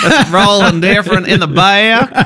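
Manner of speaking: mock her drawled Australian vowels through laughter; teasing accent mimicry. Co-host teasing host about her tongue rolling, mock Australian twang. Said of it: "This cold has made your accent a bit more".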